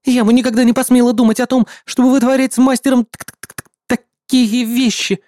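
high, stammering on the t; flustered stutter. A narrator's reading. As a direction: trip over the word, flustered.

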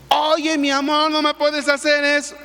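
pleading husband. Retold or imitated. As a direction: whimper for mercy, voice up. Husband begs wife.

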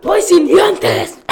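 put-on slow shouted punchline, kid voice; toothless kid shout. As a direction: yell it like a kid who lost teeth. Joke punchline child falls.

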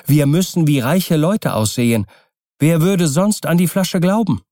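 high, lively, hopeful urging; eager wife Kokua. Acted. Kokua urges Keawe off to Tahiti, eagerly. Narrator voices brisk Kokua.

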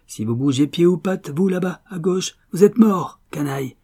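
high, rising threat, peaking loud; narrated yell. A narrator's reading. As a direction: yell a threat, building to a peak. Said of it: "hurla-t-il à Raffles".

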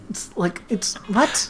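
ordinary delivery

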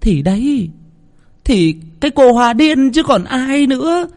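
high, loud drawn-out mother's voice; mother confirming. A narrator's reading. Mother confirming the death to her daughter, loud and drawn. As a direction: stretch out the last words.

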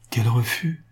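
plain reading voice

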